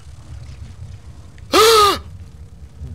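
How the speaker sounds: high startled gasp; sharp gasp. Real, not acted. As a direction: gasp in shock. Sudden scare.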